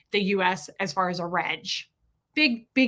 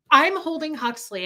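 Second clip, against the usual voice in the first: plain reading voice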